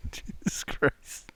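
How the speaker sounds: laughing under breath; breathy laugh through the words. Real, not acted. Laughing at the joke.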